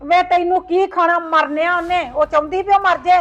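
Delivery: angry scolding. Acted. Mother scolds son.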